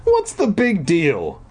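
slow, high-pitched challenge; dismissive insistence. A narrator's reading. Brother doubts sister's alarm, dismissive. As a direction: stretch it out, unimpressed. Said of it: "he insisted".

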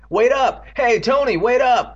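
loud, high call. Acted. Rick calls to his fleeing brother Tony, calling out. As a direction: shout after someone running off.

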